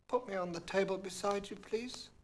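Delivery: a small creature asking politely; frog's voice. A narrator's reading. Frog asking the princess at table, lightly voiced. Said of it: "the frog said".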